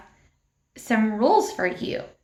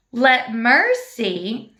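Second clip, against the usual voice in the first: slowly drawn-out words; slow recitation; much slower than usual. Shown as a demonstration. Teacher reciting the verse slowly with hand motions. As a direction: stretch each word for the children. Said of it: "we're gonna do like this for mercy".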